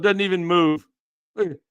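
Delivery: usual speaking voice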